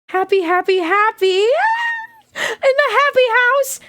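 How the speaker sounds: very high singsong chant; shrieking cheer. Real, not acted. Streamer celebrating the happy house, shrieked chant.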